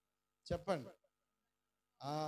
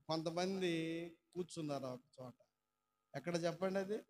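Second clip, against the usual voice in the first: ordinary talk, nothing marked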